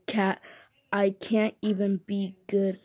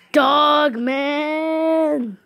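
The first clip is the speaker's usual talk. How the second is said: higher and louder, calling out the title hero; excited shout; 6 semitones higher, 7 dB louder than usual. Acted. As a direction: shout it like a cheering kid. Kid calls out character name.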